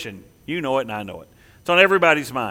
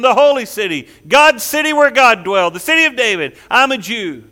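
higher, louder boasting voice, swelling with pride; proud boaster; 4 semitones higher, 3 dB louder than usual. Acted. Preacher voicing a proud exile boasting of Jerusalem. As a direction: play the proud claimer puffing up his identity.

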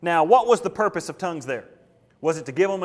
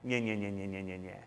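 much softer, lower nya nya babble; quiet, low nonsense syllables fading out; gibberish babble; 9 semitones lower, 12 dB quieter than usual. Retold or imitated. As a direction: let it sound like meaningless noise. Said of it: "it will sound like".

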